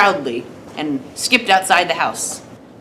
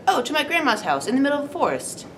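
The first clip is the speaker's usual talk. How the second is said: put-on high, quick little-girl voice; high-pitched, chatty girl; little girl character; 7 semitones higher, faster than usual. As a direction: answer high and fast as a storybook girl. Little girl answers the wolf.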